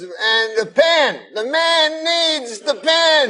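high, slow mock Cosby; Bill Cosby impression. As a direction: stretch "pen" out high like Cosby. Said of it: "so he goes".

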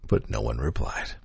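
deadpan hush. Acted. Podcast host ending the letters, low soft deadpan.

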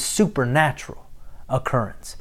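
whispered. Real, not acted. Whispered end of sentence.

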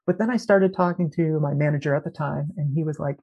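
ordinary delivery

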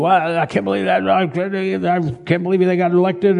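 high, whiny run-on grumbling; mock fussing. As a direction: imitate someone fussing at the news. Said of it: "make you fuss".

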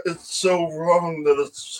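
usual speaking voice

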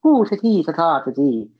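lullaby-like Republic of Ireland accent; Irish leprechaun lilt. Shown as a demonstration. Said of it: "like a lullaby, like, kinda like your leprechaun".

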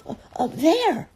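high, breathless squeak; frightened Piglet. A narrator's reading. Piglet pointing out the heffalump, high and breathless. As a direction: gasp it out in a small high voice. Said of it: "said Piglet".

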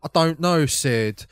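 loud, hammy mock Cockney. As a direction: exaggerate a comedy Cockney accent. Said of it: "stereotypical Cockney British accent".

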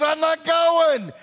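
high, loud, drawn-out refusal; defiant shout. Acted. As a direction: play a stubborn husband digging in. Acted quarrel, husband refuses again.

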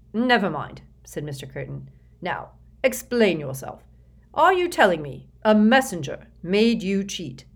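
a suspicious boss pouncing on a name; a self-satisfied executive interrogating the boy; Mister Curtin's voice. Acted. Curtin demands explanation.

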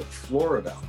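ordinary talk, nothing marked

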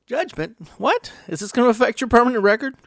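high-pitched, incredulous questions; hammed-up shock, racing into the last question; mock alarm. Reacting to someone passing judgment, mock alarmed.

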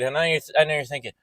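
usual speaking voice

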